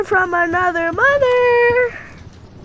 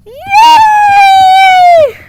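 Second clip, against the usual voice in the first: high loud cheer; a burst of joy at finding the key; 1 excited yay; 10 semitones higher, 7 dB louder than usual. Real, not acted. Gamer cheering as the door finally opens, high excited yay. Celebrating in game.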